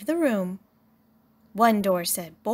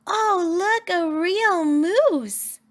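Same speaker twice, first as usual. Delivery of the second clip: put-on high, amazed kid voice; excited child voice; 9 semitones higher, 5 dB louder, slower than usual. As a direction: gasp it out like a thrilled child. Excited children see moose.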